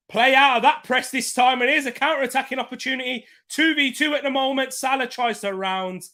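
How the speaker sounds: fast, loud, high match commentary; live play-by-play. Acted. Fan hosting a watchalong calls the play live, commentator style. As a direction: call the counter-attack like a TV commentator.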